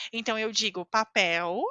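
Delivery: "papel" ending in a U sound, pa-PEW; "papel" with L vocalized to U; final L as U. Shown as a demonstration. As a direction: close "papel" with a U, not an L. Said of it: "eu também pronuncio como U".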